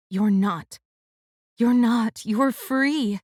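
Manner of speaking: plain reading voice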